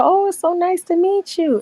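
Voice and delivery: high, slow sugary fake politeness; fake polite greeting. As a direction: play dumb with a sweet high voice. Feigning not to know ex.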